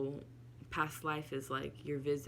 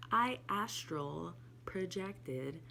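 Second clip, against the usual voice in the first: "astral projected" said very slowly, word by word; slow right down to get "astral projected" out; "I astral projected" spelled out slowly after a stumble; slow careful try; much slower than usual. Shown as a demonstration. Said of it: "I can never say that so fast".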